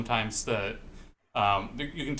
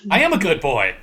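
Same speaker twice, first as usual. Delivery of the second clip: loud, higher insistent answer; emphatic answer; 6 semitones higher, 12 dB louder than usual. Retold or imitated. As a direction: declare it loudly with a falling end. Acting out both sides of exchange.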